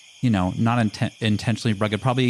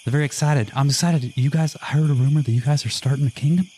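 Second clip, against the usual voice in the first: put-on higher, lively character voice with an accent; excitable, higher-pitched accented character; 6 semitones higher than usual. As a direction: introduce yourself as an eager newcomer, accent on. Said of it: "how to do my accent".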